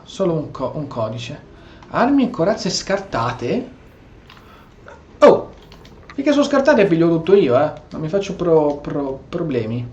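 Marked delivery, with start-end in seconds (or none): none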